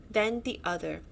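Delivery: ordinary delivery, nothing marked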